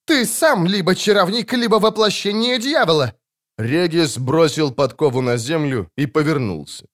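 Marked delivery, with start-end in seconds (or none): 0.1-3.1 high accusing cry; accusing priest. A narrator's reading. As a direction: denounce the barber as a devil.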